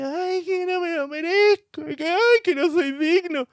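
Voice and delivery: high, whimpering mock lament; mock a weepy sinner moaning unworthy; whiny self-pity. Preacher mocking a weepy believer, high whiny lament. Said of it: "como una Magdalena, llorando".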